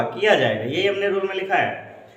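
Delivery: ordinary delivery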